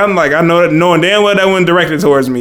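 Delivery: ordinary delivery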